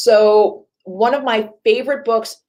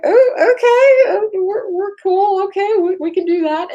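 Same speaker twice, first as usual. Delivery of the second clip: nervous cave-in; 13 semitones higher than usual. Retold or imitated. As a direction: squeak it out like a scared scientist hiding panic. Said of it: "the scientists were like".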